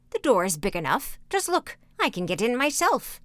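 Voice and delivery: lightly voiced impatient crone; a hint of exasperated old woman; old witch. A narrator's reading. Witch shows Gretel the oven door, lightly impatient crone voice. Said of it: "said the old woman".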